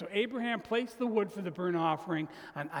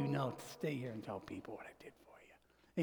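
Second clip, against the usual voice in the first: gentle whispered reply; Jesus answers softly in a whisper; whispered tender command; 4 semitones lower, 9 dB quieter than usual. Acted.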